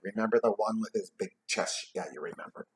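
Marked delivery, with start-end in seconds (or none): none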